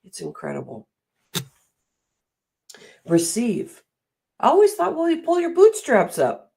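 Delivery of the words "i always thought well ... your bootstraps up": imitating a scolding elder. Mimicking the bossy voices she grew up with, scolding. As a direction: voice the stern parent who said it. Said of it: "I heard that many times for myself".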